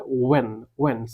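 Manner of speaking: "ওয়েন" said twice, w first, no h; correct pronunciation. Shown as a demonstration. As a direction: model "ওয়েন" as wen. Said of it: "তাহলে এটা উচ্চারণ হবে".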